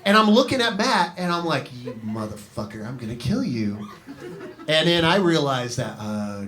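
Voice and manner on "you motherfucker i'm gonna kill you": low, quiet mock threat; hushed, low-pitched menace, played for laughs; muttered threat. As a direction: drop the voice down and threaten softly. Acted muttered threat to friend.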